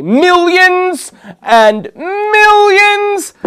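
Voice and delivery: stretched, high, loud showman's boast; hyped announcement. Pretend. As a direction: blow it up like a prize-show host. Hyped announcement of prize giveaway.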